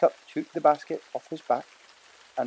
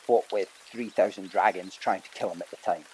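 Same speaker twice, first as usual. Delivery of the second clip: plain reading voice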